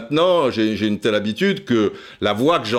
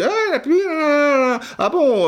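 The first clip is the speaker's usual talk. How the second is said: a much higher, squeaky startled "ah, la pluie"; startled woman. Retold or imitated. Passer-by surprised by the rain question, high startled voice. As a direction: play the passer-by caught off guard by a silly question. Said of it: "Alors elle dit".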